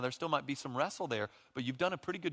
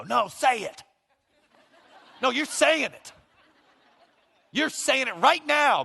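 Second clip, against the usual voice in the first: much higher, louder taunting, laughing through it; mock demanding spouse; 13 semitones higher, 11 dB louder, slower than usual. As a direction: goad them into saying it. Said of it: "One of the dumbest things you could do is go".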